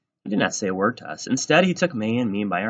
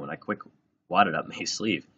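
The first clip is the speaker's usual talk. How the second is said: ordinary delivery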